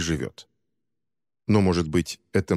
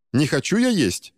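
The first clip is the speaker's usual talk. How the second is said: voiced protest, higher; refusing, fending off; 6 semitones higher than usual. A narrator's reading. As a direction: push the food away, a bit childish. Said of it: "отбивался Шанкар".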